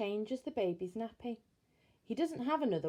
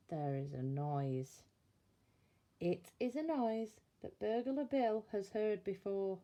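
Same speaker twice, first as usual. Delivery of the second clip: plain reading voice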